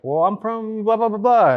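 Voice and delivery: high-pitched imitation of a North Dakota accent; Barbara. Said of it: "'cause of that accent".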